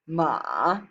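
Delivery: "ma" dipping low, then back up; drawn-out "ma" that sinks and climbs; third tone. Shown as a demonstration. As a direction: dip the pitch deep on "ma" and come back up. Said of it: "third tone is a low dipping tone".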